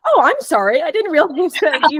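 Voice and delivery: apologetic local suddenly sounding fully American; American accent. Retold or imitated. Danish stranger apologizing to a tourist, in a mock perfect American accent. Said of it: "like, in a perfect American accent".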